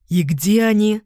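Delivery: impatient question. Acted. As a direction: snap the question. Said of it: "нетерпеливо спросил Бон". (нетерпеливо)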